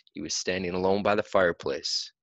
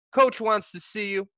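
teammate; 12 semitones higher, 4 dB louder than usual. A narrator's reading. Teammate voiced in story.

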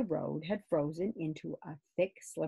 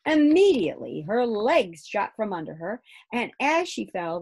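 ordinary delivery, nothing marked

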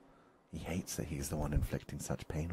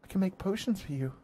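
high, loud, desperate wail; very high anguished pleading; 6 dB louder than usual. A narrator's reading. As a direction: wail and beg him to stay. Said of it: "she wails".

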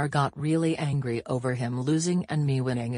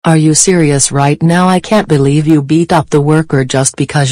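loud, angry scolding rant; furious yelling; 16 dB louder than usual. Acted. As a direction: yell the whole rant straight at him. Babysitter scolding Lamar furiously.

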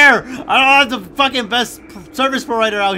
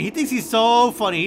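usual speaking voice

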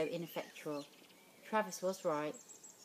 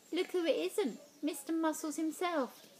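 mocking taunt; 10 semitones higher than usual. Bully taunting narrator.

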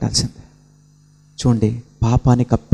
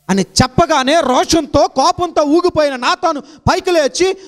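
high, loud climactic preaching; preaching at full force; 3 dB louder than usual. Acted. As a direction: build the sermon to a ringing peak. (ఉద్రేకంతో బోధించే స్వరం)

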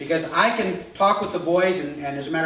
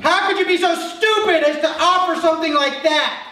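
high, loud furious yelling; mock furious wife; angry outburst; 13 semitones higher, 6 dB louder than usual. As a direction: snap at him in outrage. Acted angry wife quote.

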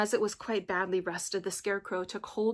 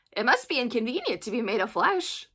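louder, animated Scarecrow voice; thoughtful Scarecrow; 2 semitones higher, 7 dB louder than usual. A narrator's reading. Scarecrow remarking to Dorothy on her needs, thoughtful and animated. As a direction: voice a musing Scarecrow with lively swoops. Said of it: "said the Scarecrow thoughtfully".

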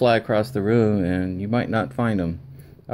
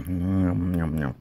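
playful eating noises; nom nom; 2 semitones lower, much slower than usual. Real, not acted. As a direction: nom nom while chewing happily. Eating shortcake, savoring it.

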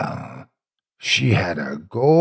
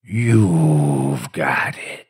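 low ghostly accusation; 8 semitones lower, much slower than usual. A narrator's reading. Jump-scare ending of tale.

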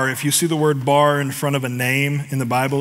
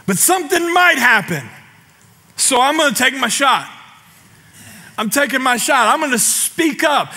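higher, louder preaching at full force; a pastor exhorting his congregation; fired-up preaching; 10 semitones higher, 4 dB louder than usual. Acted. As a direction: preach with rising passion. Preaching fervently.

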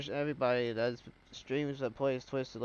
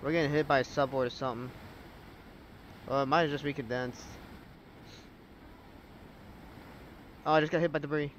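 excited; 3 semitones higher, faster than usual. Real, not acted. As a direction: call it out as it hits. Direct hit from tornado.

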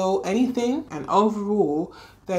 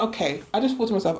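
usual speaking voice

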